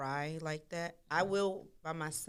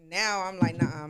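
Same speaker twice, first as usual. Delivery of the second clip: louder, hammering the point home; loud emphatic; 4 semitones higher, 11 dB louder than usual. Real, not acted. Explaining she now refuses to hide, loud. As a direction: insist with full force.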